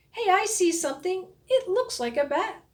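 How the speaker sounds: high, quick and eager; excited discovery. A narrator's reading. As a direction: blurt it out, spotting something. Nick spots something in dark.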